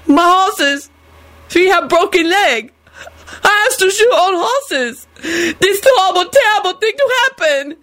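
high, plaintive grieving wail; near tears. A narrator's reading. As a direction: lament it on the verge of sobbing. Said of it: "He burst into tears".

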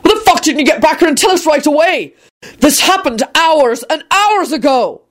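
high-pitched, furious tirade; raging at someone who kept bad news back; wrathful bellow. A narrator's reading. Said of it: "Quentin bellowed wrathfully".

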